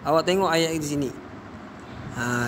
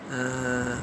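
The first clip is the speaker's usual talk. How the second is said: ordinary talk, nothing marked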